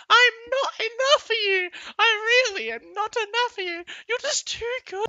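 very high, whiny breakup voice; mock the sobbing girlfriend; squeaky fake-tearful girlfriend.

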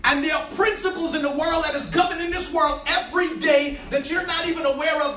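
ordinary delivery

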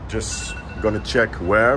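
ordinary talk, nothing marked

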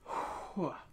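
big unvoiced puff of air; winded exhale. Real, not acted. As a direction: blow out a breath to recover. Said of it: "I'm out of breath".

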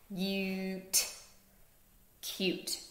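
Cute said softly as separate sounds; sounds chopped apart. Shown as a demonstration. Teacher chopping Cute quietly for the class. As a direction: chop Cute into its sounds, gently. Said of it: "Let's chop it out".

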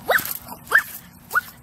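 very high unvoiced puffs of woof; breathy dog bark. Child singing about her dog, tiny whispered puppy barks.

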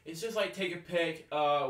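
normal talking voice

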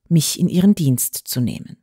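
usual speaking voice

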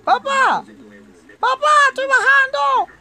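very high, loud yell; panicked calling out. Real, not acted. Player calling papá while going down, high shouting.

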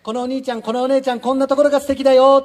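loud model shout. Shown as a demonstration. Host shows children how to praise students, loudly called out. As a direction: demonstrate a big-voiced compliment. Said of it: "みんなで大きな言葉で".

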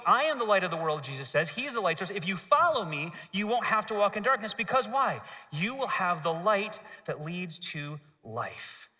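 usual speaking voice

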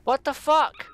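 loud, high furious outburst; real gamer rage, shouting; angry yell. Real, not acted. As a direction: yell it at the screen in disbelief. Rage at teammate's throw.

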